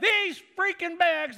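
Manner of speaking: slow, high, loud ranting; mock furious complaint. Pastor voicing the angry passenger ranting about heavy bags, slow and loud. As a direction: drag out the complaint in a rage.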